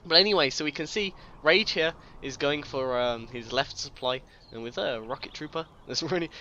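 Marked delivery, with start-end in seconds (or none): none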